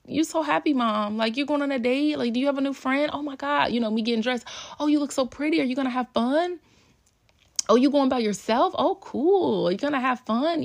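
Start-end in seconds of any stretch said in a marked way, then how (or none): 0.0-3.7 put-on high, bubbly kid voice; excited kids voice. Kids voiced cheering mom. 4.8-10.6 put-on high, bubbly kid voice; excited kids voice. Kids voiced admiring mom.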